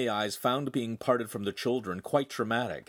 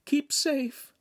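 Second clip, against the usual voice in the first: weak, tearful. Acted. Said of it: "she said weakly".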